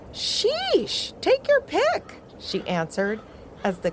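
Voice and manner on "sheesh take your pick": put-on very high, slow, exasperated girl's voice. A narrator's reading. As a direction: roll your eyes and drawl it out high. Taffy's exasperated answer.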